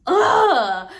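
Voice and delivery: loud frustrated ugh; annoyed groan. Retold or imitated. Storyteller groans at the instructor, exasperated loud ugh. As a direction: groan it out, fed up. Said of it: "I was so annoyed".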